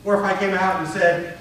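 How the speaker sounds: usual speaking voice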